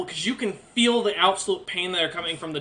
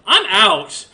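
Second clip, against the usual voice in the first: loud, raised-pitch outburst; loud exit line; 4 semitones higher, 10 dB louder than usual. Acted. Soldier abandoning the party through a door, loud outburst. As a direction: blurt it out loudly and bail.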